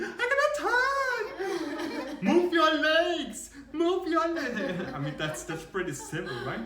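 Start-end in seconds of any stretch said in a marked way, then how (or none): 0.0-4.9 very high, slow, loud panicked yell; mocking scream. Retelling the girl screaming on the slope, mocking panic. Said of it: "she just screamed".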